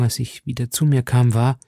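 usual speaking voice

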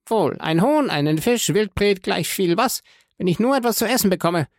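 high-pitched, urgent food order; impatient hungry prisoner. A narrator's reading. Starving banker ordering anything edible from his guard, impatient. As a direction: list dishes impatiently, then rush the ending.

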